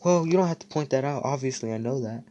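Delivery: high, loud, fast huffy comeback; defensive retort. Retold or imitated. [name] to Austin after being praised, huffy and defensive. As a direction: snap back, stung and defensive. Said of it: "And I was like".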